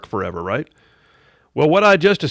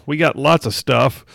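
ordinary delivery

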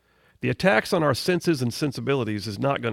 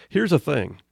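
normal talking voice

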